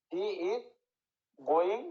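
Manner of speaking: halting, with long pauses between chunks; spaced-out teaching example sentence; word-by-word example. Shown as a demonstration. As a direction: say it piece by piece, leaving gaps. Dictating an example for scansion.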